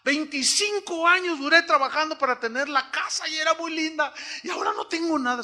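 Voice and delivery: high pained voice of a woman who lost her house, rising to a cry; mock wail over the lost house; imitating a crying woman. Woman on CNN laments her lost house, high and pained. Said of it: "una señora llorando decía".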